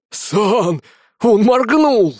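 high, loud alarmed yell; panicked shout. Acted. Thug panics, shouts to partner.

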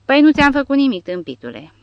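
high, loud protesting outburst; exclaiming. A narrator's reading. Barcelona answering the little one's threat, loud indignant cry. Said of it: "exclamă Barcelona".

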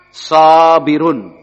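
Sabirun said very slowly and deliberately; slow model word. Shown as a demonstration. Teacher modelling the word for students, slow. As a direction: drag out Sabirun for the class to hear. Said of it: "Isim atau kata benda".

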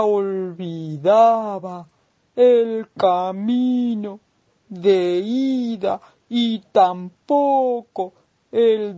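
voz de tortuga lentísima, con vocales alargadas; tortuga pausada. Acted. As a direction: responde muy despacio, arrastrando cada palabra como una tortuga. La tortuga responde al caracol. (tortuga muy lenta y pausada)

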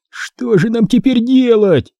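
high, slow, desperate question; strained wheeze. A narrator's reading. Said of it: "просипел я". (отчаянно, на высокой ноте)

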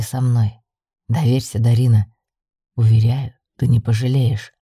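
deep, controlled male character voice; ominously persuasive male lead. Acted. Йен urging the heroine to come with him, deep and assured. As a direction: urge trust in a low, firm voice.

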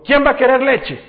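high, loud parental call. Retold or imitated. Dad offering milk to his children in the morning, calling out. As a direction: call cheerily to the whole family.

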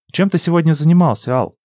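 lightly voiced curious girl; girl's question. A narrator's reading. Lina asks, higher pitch.

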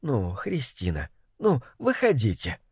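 soft, low, kind coaxing; gentle. A narrator's reading. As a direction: coax her out gently. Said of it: "ласково говорил ей".

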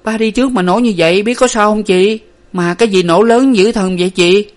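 high, loud anxious questioning; urgent, frightened girl's voice; pressing questions. A narrator's reading. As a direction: press your sister for answers, worried. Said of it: "Hà níu chị hỏi dồn".

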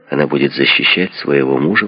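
ordinary delivery, nothing marked

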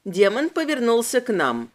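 ordinary delivery, nothing marked

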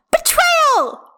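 put-on very high, loud childish outrage; shriek. A narrator's reading. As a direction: shriek it like a betrayed little girl. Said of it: "Hestia shrieks".

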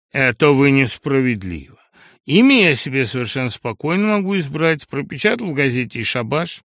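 a brash lout talking back; crude upstart voice. Acted. Sharikov answers about his name.

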